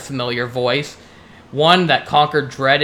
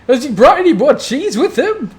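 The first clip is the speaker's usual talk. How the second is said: Marvin the Mouse; 12 semitones higher, 7 dB louder than usual. Acted. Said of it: "He yawns and says".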